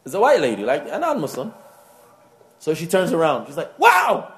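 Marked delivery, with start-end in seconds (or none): 3.8-4.3 sudden high-pitched wow of amazement; surprised wow. Retold or imitated. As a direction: gasp it out in high delight. Lady amazed at wife.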